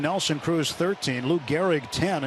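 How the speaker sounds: ordinary talk, nothing marked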